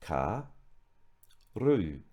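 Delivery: karu split into ka and ru; syllables split. Shown as a demonstration. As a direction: say karu one syllable at a time. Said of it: "break down again the two syllables".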